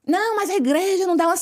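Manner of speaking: indignant high protest; mock indignant husband protesting; revolted husband. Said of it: "revoltado, né?".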